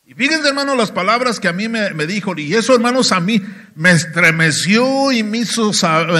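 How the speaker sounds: usual speaking voice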